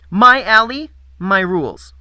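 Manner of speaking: high, loud, bossy and nasal; bossy nasal girl. Acted. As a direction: boss them like a playmate. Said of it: "Her bossy, nasal voice".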